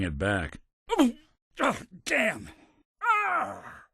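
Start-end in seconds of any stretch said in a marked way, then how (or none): 1.6-2.5 high-pitched cry of alarm; alarmed curse. Acted. Hit or alarmed during combat. 3.0-3.8 very high cry of pain; pained yell. Acted. Hurt during combat.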